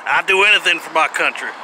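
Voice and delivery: hammed-up Southern accent. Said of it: "a Southern accent or what some people call a country accent".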